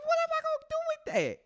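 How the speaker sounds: very high, fast squeaky outrage; comic disbelief. Retold or imitated. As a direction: squeak it out in mock confusion. Retold baffled reaction.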